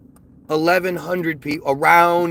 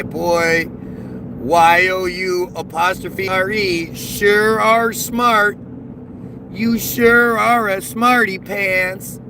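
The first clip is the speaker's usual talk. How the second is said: slow, louder sarcastic drawl; sarcastic praise; 2 semitones higher, 3 dB louder, much slower than usual. Acted. Mocking a commenter's spelling.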